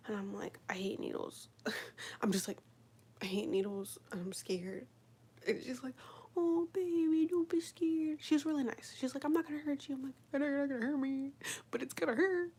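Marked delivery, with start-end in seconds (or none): 0.7-4.9 whispered, scared little confession; hushed as if holding back tears; whispered fear. Retold or imitated. Telling the nurse she hates needles, whispered. 6.4-10.0 higher, lightly voiced comforting nurse; mock soothing nurse. Nurse comforting a scared patient, higher soothing voice. 10.3-12.5 put-on higher, whiny little-kid voice; whiny reply. Answering the nurse, whiny and higher.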